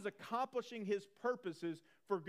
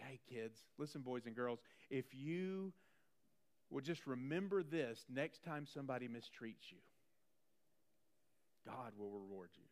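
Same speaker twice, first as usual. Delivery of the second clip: put-on low, hushed teacher talking to children; imitated Sunday school teacher; 8 semitones lower, 8 dB quieter than usual. Sunday school teacher to kids, soft imitated lesson voice. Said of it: "This is where our Sunday school teachers".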